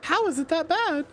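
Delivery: incredulous. Real, not acted. Laughing disbelief at own accent.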